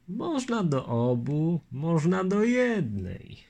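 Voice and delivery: a hint of the polite Lithuanian; Litwin's voice. Longinus asking the lieutenant his name, lightly voiced.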